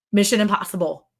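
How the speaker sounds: normal talking voice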